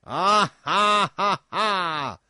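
loud, high mocking king's laugh; roaring laugh. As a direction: roar with cruel laughter. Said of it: "roared the latter".

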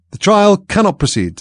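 slow, loud, high solemn pronouncement; very grave. A narrator's reading. King halting the trial, grave loud slow declaration. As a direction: declare it gravely, slowly and loudly. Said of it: "in a very grave voice".

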